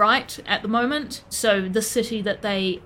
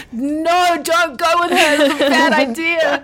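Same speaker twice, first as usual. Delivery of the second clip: loud, raised-pitch playful protest; alarmed mock warning; 6 semitones higher, 7 dB louder, slower than usual. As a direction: shout a joking warning to the characters. Player mock-warns the characters.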